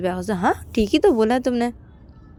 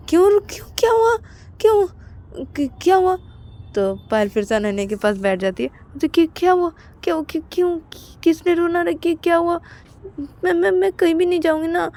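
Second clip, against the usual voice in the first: much higher, anxious stammering; worried lover; 9 semitones higher than usual. Acted. Payal rushing back to comfort crying Ananya. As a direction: fuss over him anxiously.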